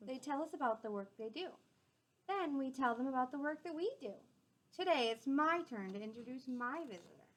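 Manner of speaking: gentle storybook read-aloud; clear, friendly storytime voice; reading to children. A narrator's reading. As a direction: read it out to the class like a picture book. Reading a picture book aloud.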